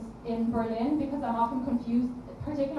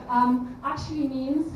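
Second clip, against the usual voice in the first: ordinary talk, nothing marked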